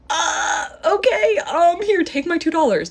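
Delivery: very high, loud flustered squeal; acted freak-out. Woman giving stranger her two dollars, acted flustered panic. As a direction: hand it over in mock panic.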